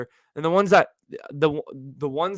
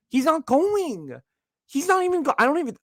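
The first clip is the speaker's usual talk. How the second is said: incredulous; 12 semitones higher than usual. Real, not acted. Disbelief at tour dates.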